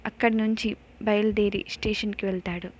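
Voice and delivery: usual speaking voice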